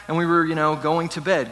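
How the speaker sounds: usual speaking voice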